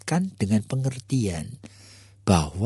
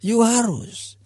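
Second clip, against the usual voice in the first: insisting father; 9 semitones higher, slower than usual. Acted. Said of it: "papanya mengatakan".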